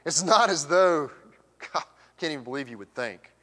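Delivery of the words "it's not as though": louder, slower, indignant emphasis on not; Paul's attitude. Shown as a demonstration. Preacher voicing Paul's attitude, loud indignant stress. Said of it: "Paul's got some attitude here".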